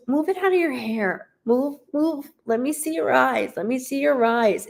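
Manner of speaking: gently urging her to move her hair; voicing the mother. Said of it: "She goes".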